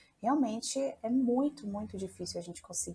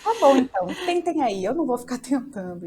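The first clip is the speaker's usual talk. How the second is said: loud and fed up; a loud, exasperated tá bom; loud resigned retort; 4 semitones higher, 8 dB louder than usual. Retold or imitated. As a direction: throw it back at them and leave. Retold storming off.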